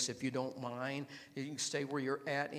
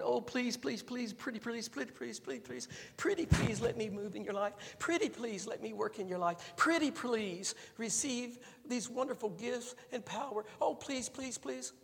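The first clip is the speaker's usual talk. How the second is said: mock pleading God; 7 semitones higher than usual. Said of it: "God's sitting on his throne ... going".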